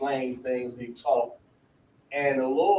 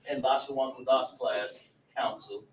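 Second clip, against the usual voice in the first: ordinary talk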